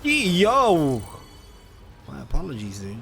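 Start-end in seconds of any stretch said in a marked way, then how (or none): 0.0-1.0 loud high call of Yo; shouting Yo at someone in the road. Real, not acted. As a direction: yell it out to get attention. Calling out to a pedestrian.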